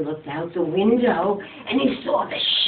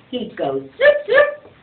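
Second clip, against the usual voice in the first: loud zoop, zoop; magic zoop sound; 6 dB louder, slower than usual. Acted. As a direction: zap it like a magic wand.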